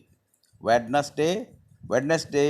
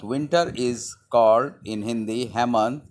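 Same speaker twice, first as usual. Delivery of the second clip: ordinary talk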